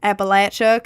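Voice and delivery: "Appalachia" ending in "latch-uh", said firmly; native pronunciation. Shown as a demonstration. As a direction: punch out "Appalachia" as "apple-atch-uh". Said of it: "'cause most people have the accent".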